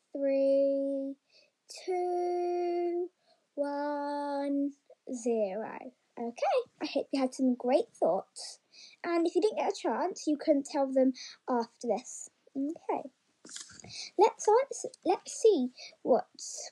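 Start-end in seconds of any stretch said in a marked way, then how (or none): none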